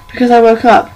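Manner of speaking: usual speaking voice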